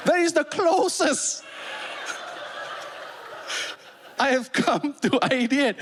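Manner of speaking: high mock crying; teary hammed-up confession; mock tearful. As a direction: ham up the heartbreak. Exaggerated emotional punchline.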